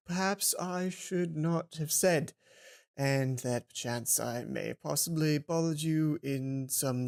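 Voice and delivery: awkward schoolboy reading a formal apology, slow and low; slow, low stilted boy. Acted. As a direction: stumble through a stiff, pompous apology slowly. Shane character reading apology.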